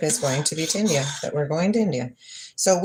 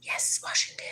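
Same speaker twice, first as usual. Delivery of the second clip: whisper; 9 dB quieter than usual. Real, not acted.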